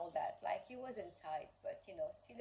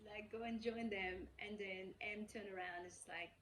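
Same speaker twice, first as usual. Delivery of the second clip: ordinary delivery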